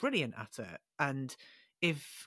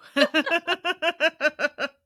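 loud laugh; 12 semitones higher, 10 dB louder than usual. Real, not acted. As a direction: burst of high loud laughter. Laughs at the sarcastic joke.

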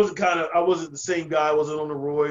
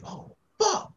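much higher worried gasp of a curse; panicked inner curse. Retold or imitated. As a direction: squeak it out in dread. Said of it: "I'm like".

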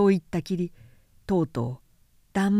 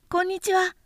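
put-on light, high boyish voice, curious; a small boy asking innocently; little prince voice; 11 semitones higher than usual. A narrator's reading. Little prince asking the vain man, high childlike voice.

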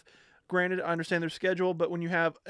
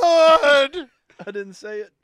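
a sudden shout on the first word, then dropping back; yelling across the room; 8 semitones higher, 11 dB louder, slower than usual. Real, not acted. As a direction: blurt it out loudly, then calm down. Protesting loudly to the room.

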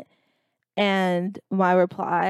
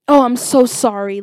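mock-distressed apology, a bit loud; put-on woe; playing the victim; 3 semitones higher, 6 dB louder than usual. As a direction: imitate a mother's overdone apology. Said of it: "the victimization of herself".

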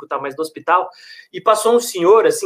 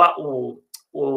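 usual speaking voice

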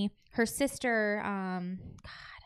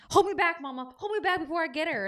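mock fighter being held back; 7 semitones higher than usual. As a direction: act out straining to get at someone, pitched high. Acting out a held-back lunge.

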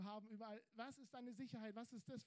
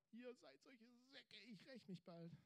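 mock vengeful Jesus; 3 semitones higher, 7 dB quieter than usual. Preacher joking what Jesus did not say, softly. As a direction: mutter the revenge as a joke.

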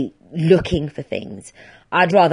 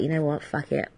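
low, soft mock give-up; quiet fed-up; 4 semitones lower, 9 dB quieter than usual. Quitting the podcast, mutedly fed up. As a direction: shrug it off under the breath. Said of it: "I might be just like".

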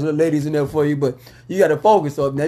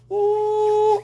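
excited ooh. Real, not acted. Excited about playoffs.

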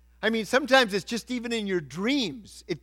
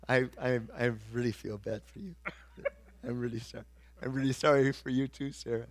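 low, very soft condolence; mock soft sympathy; 6 semitones lower, 10 dB quieter than usual. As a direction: lower your voice into helpless, acted commiseration. Acting out powerless sympathy to friend.

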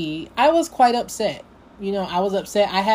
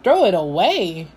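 disbelieving, with pitch leaping up and down; incredulous echo; 4 dB louder than usual. Retold or imitated. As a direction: repeat it back in shocked disbelief. Shocked echo of friend's words.

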